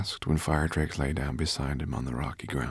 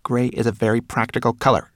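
high, loud, offended rat; huffy little rat retort; spat indignantly; 5 semitones higher, 7 dB louder than usual. A narrator's reading. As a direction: snap back, stung by the insult. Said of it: "spat the rat".

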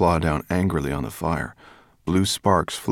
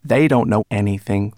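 peevish, louder rat complaint; 3 semitones higher, 7 dB louder than usual. A narrator's reading. Rat griping about others' ignorance, peevish. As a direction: grumble it out crossly and loudly. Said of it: "the rat continued peevishly".

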